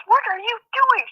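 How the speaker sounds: very high-pitched imitation of a scolding wife; alarmed wife. Wife catching husband at the border, alarmed scolding. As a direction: demand an answer as the horrified wife.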